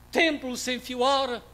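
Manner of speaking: ordinary delivery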